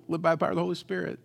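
quick, offhand recital of the slogan; stock line repeated. Retold or imitated. Pastor admitting he only told them, quick and offhand. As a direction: toss off the slogan at normal volume. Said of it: "I told them".

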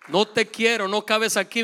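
ordinary delivery

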